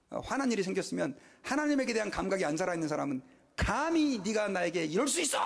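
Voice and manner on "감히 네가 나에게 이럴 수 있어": slow, indignant mock rage; hammed-up indignant snap; mock outburst. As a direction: imitate someone losing their temper. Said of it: "하고 막 성질을 내죠".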